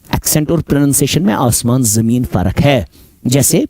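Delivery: ordinary talk, nothing marked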